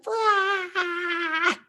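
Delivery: put-on high, far-off little baby scream; very high mock baby scream; baby shriek. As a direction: shriek like startled Lego babies. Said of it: "Luke and Leia are just like".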